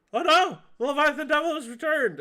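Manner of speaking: high, loud, hurried cry of alarm; alarmed kobold. Acted. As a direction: sound the alarm as a panicked kobold. Kobold alarmed at devil's return.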